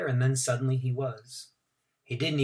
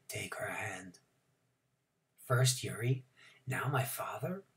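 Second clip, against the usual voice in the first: whispering; 4 semitones lower, 11 dB quieter than usual. A narrator's reading. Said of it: "Mister Milgram whispered to me".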